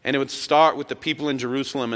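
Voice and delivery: usual speaking voice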